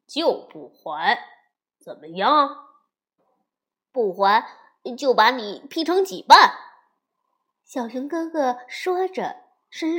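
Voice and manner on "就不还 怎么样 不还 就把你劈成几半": loud, contemptuous threat; scornful bully. A narrator's reading. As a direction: sneer it out, building to a loud threat. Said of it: "轻蔑地说". (轻蔑而大声地挑衅)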